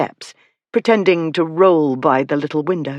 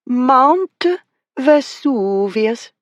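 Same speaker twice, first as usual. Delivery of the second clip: higher, drawn-out mock Italian accent on Mount Vesuvius; odd foreign accent; 9 semitones higher, much slower than usual. Girl joking at the viewing window, in a put-on accent. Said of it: "came Miss Flower's voice in an odd accent".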